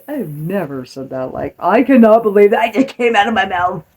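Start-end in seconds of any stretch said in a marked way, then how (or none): 1.6-3.8 a touch higher and louder, playing her own mother; half-laughing, catching herself sounding like mom; mock horrified mom voice. Telling viewers she turned into her mother, mock horrified. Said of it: "I have never used that tone of voice".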